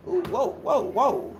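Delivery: high mock startle, then dropping off; startled wake-up. Mocking the sleeping cousin, a mock startled whoa. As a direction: act out jolting awake at something underneath. Miming waking to a baby underneath.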